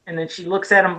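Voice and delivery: usual speaking voice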